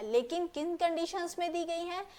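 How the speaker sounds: ordinary delivery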